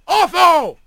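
high, urgent call. A narrator's reading. Second hawk calling to Otho in flight, shouting. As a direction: cry out the name from afar.

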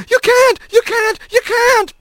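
high, frantic repeated screams; screaming. A narrator's reading. Otho protesting to the pursuing hawk, screaming. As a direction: shriek each protest in desperation. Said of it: "Otho screamed".

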